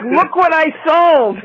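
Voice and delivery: high, slow gleeful cry; mock excited squeal. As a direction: echo it as the proud seller. Mirrors the line as seller.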